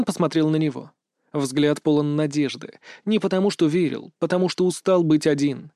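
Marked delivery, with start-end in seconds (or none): none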